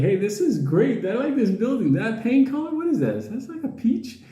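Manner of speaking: lightly voiced chirpy dog chatter; high-pitched mock-happy dog. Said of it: "The dog was happy".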